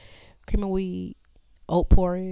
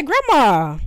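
shouted protest; 8 semitones higher, 9 dB louder than usual. Retold or imitated. Protesting to grandma.

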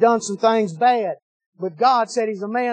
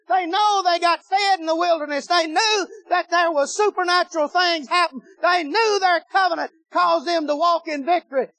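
higher-pitched preaching run that climbs; building sermon; 6 semitones higher than usual. Acted. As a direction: drive the congregation up, line by line.

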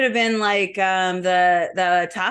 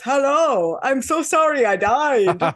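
slow, higher, odd adult voice for a cartoon dog; a lightly voiced awkward grown man as a dog; mismatched dog voice; 4 semitones higher, 4 dB louder, slower than usual. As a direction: play the wrong voice dubbed onto a dead pet. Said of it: "a voice that just wasn't gonna match the dog".

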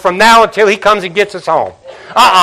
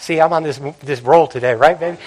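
quiet aside; 5 semitones lower, 7 dB quieter than usual. Real, not acted. Aside to wife.

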